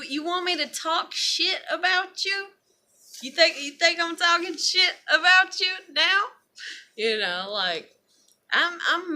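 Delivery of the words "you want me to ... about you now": higher taunting challenge to her critics. Real, not acted. Challenging people who say she talks, higher and taunting. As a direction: dare them, pitched up. Confronting imagined gossiping critics.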